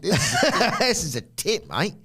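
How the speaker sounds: broad West Country yokel, laughing; slow chuckling West Country drawl; mock West Country. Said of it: "he's West Country now".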